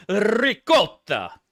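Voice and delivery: ricotta with a long rolled r, punched loud; overemphasized foreign word. Retold or imitated. Mocking people who cook on stream, overemphasized ricotta with rolled r. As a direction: hammer ricotta with a trilled r. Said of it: "When they overemphasize a foreign word".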